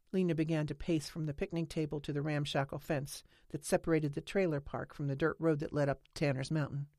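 plain reading voice

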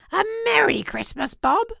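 loud, high, jubilant greeting; heartfelt earnestness. A narrator's reading. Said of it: "with an earnestness that could not be mistaken".

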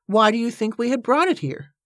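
high, exasperated question; impatient. A narrator's reading. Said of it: "said Cornelia impatiently".

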